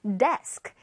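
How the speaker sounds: normal talking voice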